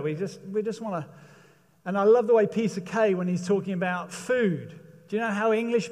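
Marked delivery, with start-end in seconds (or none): none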